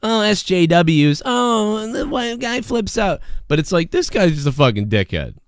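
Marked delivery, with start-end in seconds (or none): 0.0-3.2 high, slow, lightly mocking whine; mocking complainers. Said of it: "I'm sick of people".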